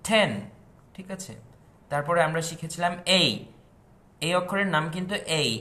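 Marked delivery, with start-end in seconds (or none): 0.1-0.3 টেন with a breathy ঠ, loud and high; aspirated ten. Shown as a demonstration. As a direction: say টেন as ঠেন. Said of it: "বলতে হবে". (টেন নয়, শ্বাস দিয়ে ঠেন)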